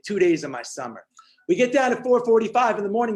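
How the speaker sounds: ordinary delivery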